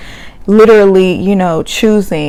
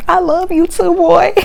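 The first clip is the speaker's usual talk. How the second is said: mimicking Amani; 10 semitones higher than usual.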